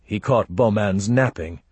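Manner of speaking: ordinary delivery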